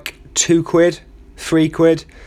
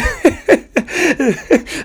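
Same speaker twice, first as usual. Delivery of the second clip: much higher giggling burst; high giggle; 12 semitones higher than usual. Acted. Soldier in the ditch cracks up, high giggle. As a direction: let a silly high giggle escape.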